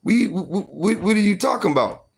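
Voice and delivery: high, fast, shouting protest; boy denying to his granny, high and frantic; panicked denial. Retold or imitated. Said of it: "I said".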